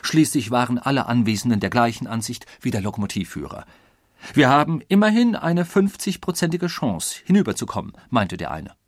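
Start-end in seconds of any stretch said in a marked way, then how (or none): 4.3-7.9 raised, louder, excited argument; eager. A narrator's reading. Passenger rallying the others for the risky crossing, eager. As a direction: pitch it up like a confident bettor.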